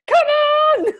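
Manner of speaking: loud high exasperated yell, then a laugh; impatient yelp mid-meditation; exasperated shout. Retold or imitated. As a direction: yell it out like you can't sit still.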